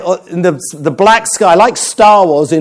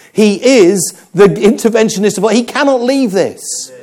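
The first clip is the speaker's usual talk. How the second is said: higher-pitched, emphatic sermon climax; impassioned preaching; 6 semitones higher than usual. Acted. Preacher tells the congregation God had to intervene, impassioned. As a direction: preach it insistently, drawing out the final words.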